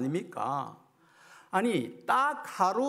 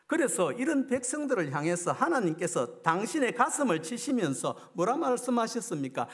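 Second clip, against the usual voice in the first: ordinary talk, nothing marked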